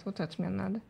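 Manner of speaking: ordinary delivery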